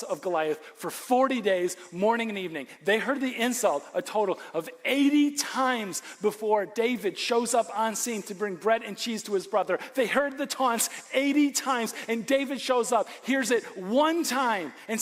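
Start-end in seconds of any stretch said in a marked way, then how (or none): none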